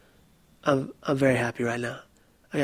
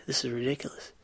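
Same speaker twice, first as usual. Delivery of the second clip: whisper; 7 dB quieter than usual. Retold or imitated. Whisper in free fall.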